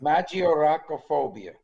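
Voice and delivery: "Magioracophobia" said with Italian vowels; Italian flourish on "Magioracophobia"; Italian accent. Shown as a demonstration. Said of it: "Notice I put the Italian accent in there".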